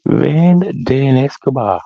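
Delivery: slow, high, savoring the name Van Dan Escobar; delighted. Real, not acted. Savoring a viewer's name, slow, high and delighted.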